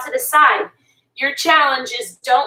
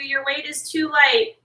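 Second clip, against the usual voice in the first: ordinary talk, nothing marked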